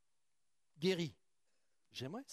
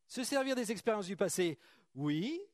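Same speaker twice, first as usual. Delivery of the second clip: louder retake; 5 semitones higher, 5 dB louder than usual. Shown as a demonstration. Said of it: "je vais la refaire".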